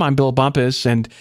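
ordinary talk, nothing marked

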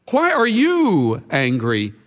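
slow line with YOU drawn out and punched; stress on YOU. Acted. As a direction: lean hard on "you", letting "angry" drop. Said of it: "the emphasis is on the pronoun".

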